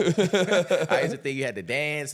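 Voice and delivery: loud, high, slow words through laughter; real laughing confession. Real, not acted. Laughs at his former self.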